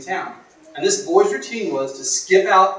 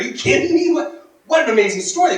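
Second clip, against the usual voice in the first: high, louder amazed gasp of words; can't believe what they just heard; stunned disbelief; 11 semitones higher, 5 dB louder than usual. Retold or imitated. Young couple stunned by the old man's story, high and amazed.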